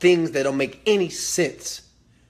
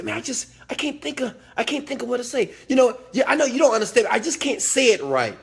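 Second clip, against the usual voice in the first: higher-pitched mock helpless voice; flustered poor communicator; 8 semitones higher than usual. Someone fumbling for what to say, mock flustered. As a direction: imitate someone stuck for words, pitched up high. Voicing people lost for words.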